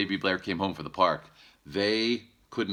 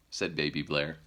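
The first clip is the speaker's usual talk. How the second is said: usual speaking voice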